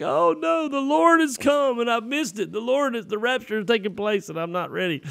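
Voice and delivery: high panicked wail; terrified he missed the rapture; freaking out. Retold or imitated. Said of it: "I started freaking out".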